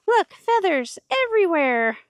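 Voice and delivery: lilting, high and bright to the birds; high chicken-talk voice. Real, not acted. Calling out to her chickens in a high sing-song voice. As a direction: coo it up high at the flock.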